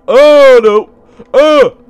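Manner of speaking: loud, high groans of dread; real loud dismay; alarmed dismay. Real, not acted. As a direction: cry out as the danger appears. Dreads what is ahead.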